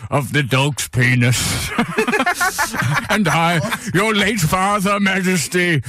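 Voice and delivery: put-on old statesman voice; Winston Churchill. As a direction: do a mock-grand statesman's growling oration. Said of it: "your Winston Churchill voice".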